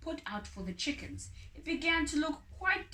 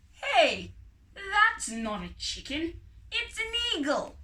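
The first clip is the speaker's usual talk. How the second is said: louder, rising to a high exclaim; surprised exclaim; 7 semitones higher, 6 dB louder than usual. A narrator's reading. Friend exclaiming to the farmer, surprised and loud. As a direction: call out in surprise.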